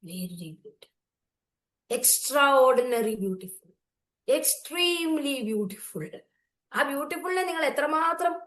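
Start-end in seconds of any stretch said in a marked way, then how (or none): 0.1-1.0 quiet very. Shown as a demonstration. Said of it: "എത്രമാത്രം beautiful". 1.9-6.2 slow emphasis. Shown as a demonstration. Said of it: "എത്രമാത്രം beautiful ആ flower".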